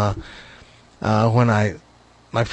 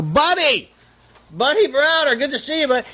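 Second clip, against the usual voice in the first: much higher, calling over from the dugout; players calling out; 12 semitones higher than usual. Retold or imitated. Cardinal players calling to Buddy from dugout, high hail. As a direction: hail him from across the railing. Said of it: "players lean over the railing and go".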